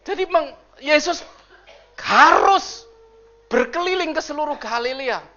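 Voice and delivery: loud, high preaching voice; preaching at full force. Acted. Pastor booming to the congregation, loud and high. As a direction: preach it out to the church.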